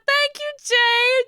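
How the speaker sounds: very high, loud, drawn-out squeal; gushing thanks. Real, not acted. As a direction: squeal your thanks to a friend. Thanks a complimenting viewer.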